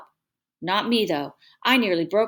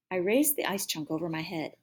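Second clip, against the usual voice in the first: ordinary delivery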